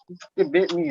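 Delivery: high, indignant yelp; indignant outrage. Retold or imitated. Bitten by a horse, high indignant yelp. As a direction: squeal it, offended. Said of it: "I was like".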